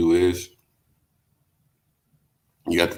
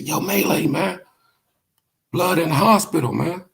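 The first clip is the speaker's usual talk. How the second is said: higher, urgent friend on the phone; worried friend calling; 11 semitones higher than usual. Retold or imitated. Homeboy calls Melee from the hospital, pitched-up urgent voice. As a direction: voice the friend breaking the news, pitched up. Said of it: "He said".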